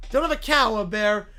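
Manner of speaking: ordinary talk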